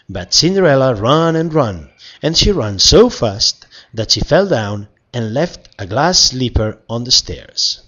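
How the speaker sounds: usual speaking voice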